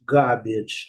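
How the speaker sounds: London-style "garbage" with no R; British English. Shown as a demonstration. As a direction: say "garbage" as GAH-bidge, long A, British. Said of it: "em Londres".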